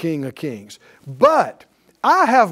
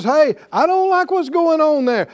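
higher, lightly acted voice of command; commanding master; 7 semitones higher, 4 dB louder than usual. The Lord calling on his delegate, commanding. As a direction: call out the order as the one in charge. Acts the Lord giving orders.

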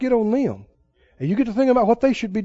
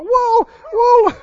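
mock flustered minister; 13 semitones higher, much slower than usual. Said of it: "he looked at me like".

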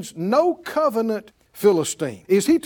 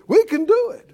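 high, loud rallying cry; confident; 13 semitones higher, 6 dB louder than usual. Acted. Caleb rallies the people in faith, confident. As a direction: declare it boldly, pitched way up.

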